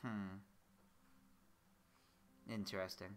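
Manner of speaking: usual speaking voice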